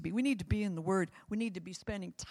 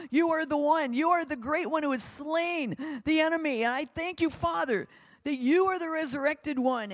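louder, climbing prayer at full force; fervent preaching prayer; 2 semitones higher, 6 dB louder than usual. Acted. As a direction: preach it, praying aloud with rising fire.

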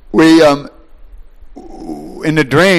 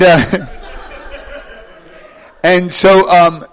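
normal talking voice

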